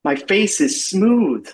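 mildly amazed, drawn-out discovery. Acted. Man feels his beard fall off, amazed.

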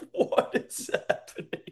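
breathless whispered bewilderment; bewildered whisper. Acted. Skinny Nick baffled by the drinking family, whispered. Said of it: "Skinny Nick's like".

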